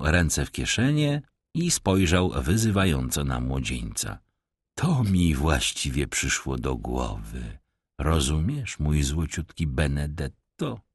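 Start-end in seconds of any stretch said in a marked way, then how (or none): 4.8-10.8 low, soft mock-humble tramp; a sly beggar bowing with false politeness; rough vagrant voice. Vagrant feigning deference to the young master, low and soft. Stranger names Benedetto, taunting.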